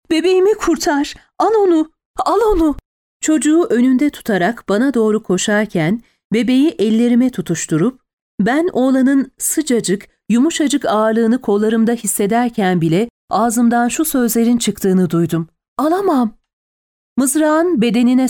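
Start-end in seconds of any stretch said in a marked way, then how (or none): 0.1-2.7 high-pitched desperate begging; screamed plea. A narrator's reading. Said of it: "kadınının çığlığını duydum". 15.8-16.4 high, pained protest; panicked refusal. A narrator's reading. Panicked refusal to dying mother.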